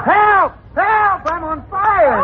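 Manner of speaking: very high, frantic screams for help; panicked yells. Acted. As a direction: scream like you're burning. Smoke filling his armor.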